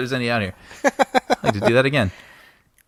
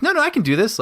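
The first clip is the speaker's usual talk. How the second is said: mock insistent actor; 13 semitones higher, faster than usual. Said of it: "you're like".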